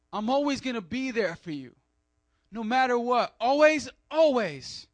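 a friend vowing always, built to a loud peak; emphatic promise. Retold or imitated. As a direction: swear it with full force. Acting out someone's promise.

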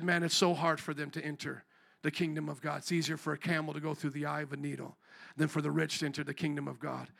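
low-key, sobering; quiet Jesus quote. Retold or imitated. Jesus' real words, preacher quoting.

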